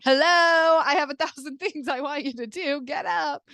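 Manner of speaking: high, insistent mock child; demanding kid. Imagined kids ordering resting Mom up, demanding. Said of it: "No, they're gonna be like".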